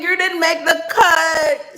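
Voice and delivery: slow, loud, drawn-out put-down; mock verdict. To rejected suitors, a slow loud taunt. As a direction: hand down the verdict slowly.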